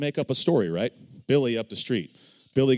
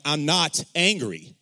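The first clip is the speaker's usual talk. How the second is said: slow, higher, emphatic mock denial; restrained anger; 4 semitones higher, slower than usual. Accused child insisting on not being angry, mounting irritation. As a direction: hold the anger in, stretching each word. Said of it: "then the anger would come out".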